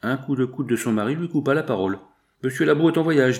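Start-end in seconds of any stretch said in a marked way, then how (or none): 2.4-3.4 louder, higher, mildly curt husband. A narrator's reading. Concierge's husband cutting his wife off, curt. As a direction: cut in with a dry, curt answer. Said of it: "dit l'homme d'un ton sec".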